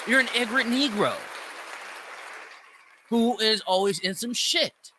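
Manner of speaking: usual speaking voice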